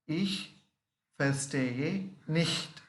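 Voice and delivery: ich verstehe nicht drawn out, nicht falling; slow repeat. Shown as a demonstration. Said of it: "Once again".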